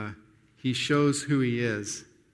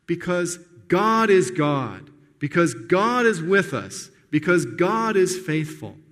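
slow, louder preaching build; emphatic preaching; 3 semitones higher, 4 dB louder, slower than usual. Acted. Preacher to his congregation, slow emphatic preaching. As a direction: press each reason home, slowly.

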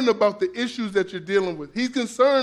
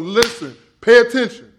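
louder, sharp command to listen; urgent call for attention; 3 semitones higher, 8 dB louder than usual. Retold or imitated. Jesus demanding the crowd's attention, loud and urgent. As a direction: demand attention, rising hard on pay attention. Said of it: "he's going".

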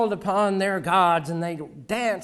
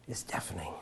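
hushed dramatic whisper; whispered for effect; 11 semitones lower, 17 dB quieter, faster than usual. Acted. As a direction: drop to a whisper on "is deafening". Dramatic silence of the scribes.

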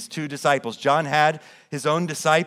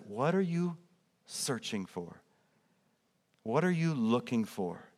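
softer, tender invitation to seekers; gentle question; 7 dB quieter than usual. Acted. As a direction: ask it quietly and kindly. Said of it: "Jesus says".

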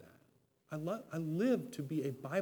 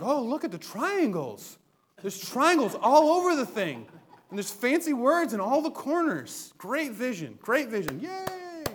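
laughing, higher, fake-impressed gushing; mock awe; 8 semitones higher, 7 dB louder than usual. Congregation mocked as dazzled by diagrams, laughing fake awe. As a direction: gush over the triangles with a laugh. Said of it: "you're like".

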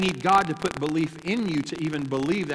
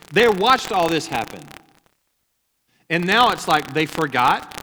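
preaching build-up; 6 dB louder than usual. Acted. Preaching at full force.

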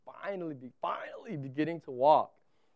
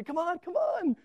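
higher, eager calling; cheering dad; 12 semitones higher than usual. Retold or imitated. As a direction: call the toddler forward. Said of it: "walking towards me and say".

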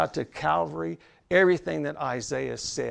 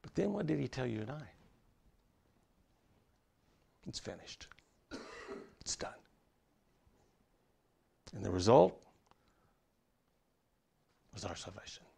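dropped to a near-whisper, much softer; hushed whisper; 5 semitones lower, 15 dB quieter, faster than usual. Acted. Preacher confides to the congregation in a hushed whisper. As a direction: pull them in close, barely above breath.

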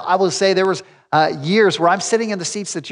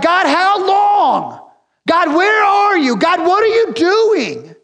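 very high, loud and slow lament; slow, high wailing cry to God; anguished plea; 12 semitones higher, 5 dB louder, slower than usual. Acted. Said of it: "David's like".

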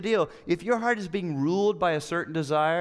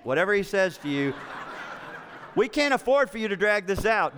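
playfully urging her to just obey; mock pleading; 5 semitones higher than usual. Husband jokingly urging his wife not to waste sessions, raised and laughing.

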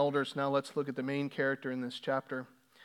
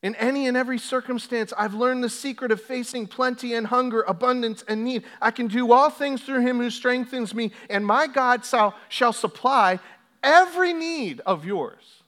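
higher, louder preaching of Paul; fervent scripture reading; 8 semitones higher, 9 dB louder than usual. Acted. Pastor reading Paul to the church, full-force preaching. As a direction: proclaim it, slowing on the last words.

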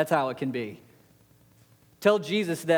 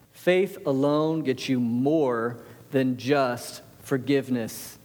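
slow emphatic repeat; 4 semitones lower, slower than usual. Shown as a demonstration.

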